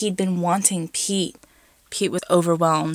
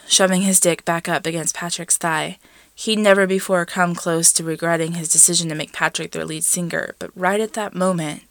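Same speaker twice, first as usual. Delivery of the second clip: plain reading voice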